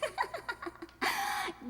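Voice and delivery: high tipsy giggle. Acted. Tracy giggles at Mike on the terrace, high tipsy giggle.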